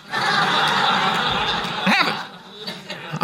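breathless laugh through the words, drawn out slowly; can barely get it out for laughing. Real, not acted. Joking about the overpriced water.

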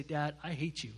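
put-on low, soft child's cold words; quiet hurtful quote. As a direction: say it softly, like a wound. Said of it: "his own kids say".

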